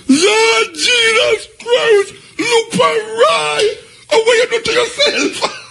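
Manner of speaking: very high, slow cry of disbelief; shocked shriek. Retold or imitated. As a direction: shriek it like you can't believe your eyes. Cousin shrieking in shock at him.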